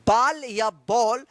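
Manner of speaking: slow बाल then rounded बॉल; बाल flat a, then बॉल with aw; wrong and right way. Shown as a demonstration. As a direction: contrast बाल with open-o बॉल. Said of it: "बैल क्यों नहीं बोलते हैं".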